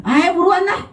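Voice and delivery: high, eager call to the angel; eager saint. Retold or imitated. Saint welcoming the angel of death, high eager voice. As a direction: urge the angel on cheerfully. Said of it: "dia mah gitu".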